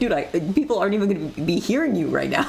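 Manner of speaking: quick, incredulous protest; mock pleading with the lecturer; student fed up with a mumbling professor; exasperated retort. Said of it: "I was like".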